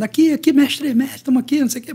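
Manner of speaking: high calling out to the coach; mock cheering. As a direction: wave him over from the stands. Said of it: "todo mundo gritando". (gritando pro mestre na arquibancada)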